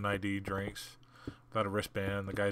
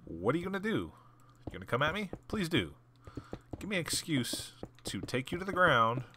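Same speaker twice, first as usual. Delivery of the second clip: taunting challenge; 7 semitones higher than usual. Retold or imitated. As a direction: dare him to try it. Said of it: "I'm like".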